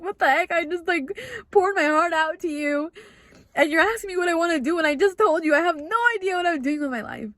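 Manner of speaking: high-pitched retold exasperation; exasperated. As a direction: protest in disbelief, pitched well up. Retold exasperated outburst at a calm listener.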